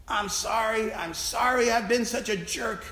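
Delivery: a hint of tearful begging; imitating crying. Weeping man apologising to the chaplain, mildly tearful. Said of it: "he cried".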